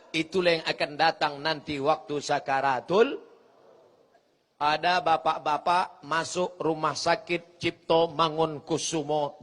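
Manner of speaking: usual speaking voice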